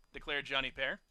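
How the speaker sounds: ordinary delivery, nothing marked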